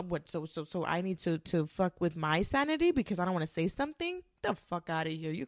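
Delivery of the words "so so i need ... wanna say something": ordinary delivery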